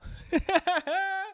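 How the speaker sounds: short high-pitched laugh. Real, not acted. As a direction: laugh at your own joke. Laughs at own joke.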